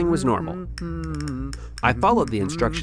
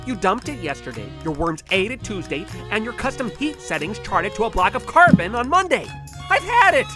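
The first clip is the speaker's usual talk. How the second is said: exasperated outburst; 11 semitones higher than usual. Acted. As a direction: vent at the breaking point. Exasperated outburst at lab partner.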